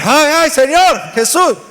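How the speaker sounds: panicked scream to the Lord; screaming uncle. Retold or imitated. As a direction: shriek out like a startled uncle in the dark. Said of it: "empezó a gritar".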